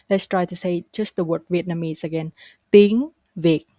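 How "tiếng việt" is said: "Tiếng" rising, "Việt" dropping abruptly; up, then abrupt drop. Shown as a demonstration. Teacher models the word Vietnamese, "Tiếng" up, "Việt" abruptly down. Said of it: "go up and then go down very abruptly".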